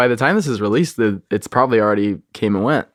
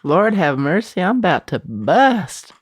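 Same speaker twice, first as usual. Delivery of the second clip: high, slow, sassy Southern drawl; camp, drawn-out Southern exclamation; Leslie Jordan impression; 7 semitones higher, slower than usual. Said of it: "What does Leslie Jordan have to say".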